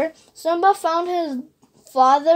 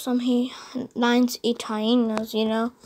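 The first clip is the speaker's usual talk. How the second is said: plain reading voice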